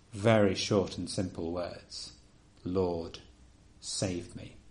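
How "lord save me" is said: soft, almost whispered plea; quiet inner prayer. Shown as a demonstration. Preacher showing the silent heart's cry, whispered. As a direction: breathe it like a private prayer. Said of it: "even in our hearts we can say these".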